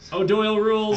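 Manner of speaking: stiff robotic chant. Retold or imitated. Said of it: "he said it like a robot".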